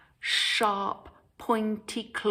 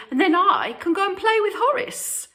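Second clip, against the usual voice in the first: high, fast, louder piggy squeal; excited squeal; 12 semitones higher, 5 dB louder, faster than usual. A narrator's reading. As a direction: squeal it out in a rush of glee. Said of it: "Boris squealed excitedly".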